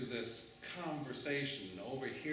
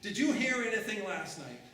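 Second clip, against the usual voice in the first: louder, higher, pressing questions; urgent questioning; 7 semitones higher, 7 dB louder, faster than usual. Acted. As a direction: press the stranger for answers. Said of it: "imagine her saying this".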